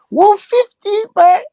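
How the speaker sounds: very high, slow, wailing falsetto; falsetto hype shout-out. Pretend. Hyping up a viewer on the stream with a high falsetto wail. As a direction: stretch it out like a hype man's wail.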